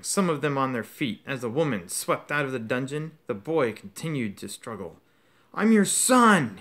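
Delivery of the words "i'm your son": high, loud, slow desperate scream. Acted. Son screaming up at his father, loud and high. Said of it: "he screamed up at Crouch".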